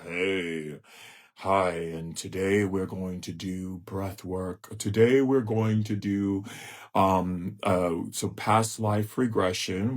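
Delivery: low, slow, sleepy monotone; mock a lifeless breath work guide; stiff dull teacher. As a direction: drone like a dull spiritual teacher. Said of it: "because you so stiff".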